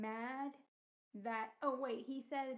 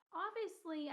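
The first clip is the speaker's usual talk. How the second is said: high-pitched mock exasperation; imitated indignation at clueless strangers; retold indignant retort; 7 semitones higher than usual. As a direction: act out a "duh" retort, pitched way up.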